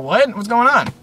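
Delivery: sharp high confused questions; startled panic. Retold or imitated. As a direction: people in the street asking what is happening, startled and high. Crowd panicking about alien attack.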